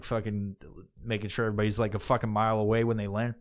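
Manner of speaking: ordinary talk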